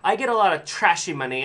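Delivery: high, loud snooty complaint; mock a disdainful cashier; snobby saleswoman. Snobby cashier complaining to a customer about money. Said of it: "and she's like".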